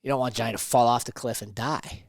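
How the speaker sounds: ordinary talk, nothing marked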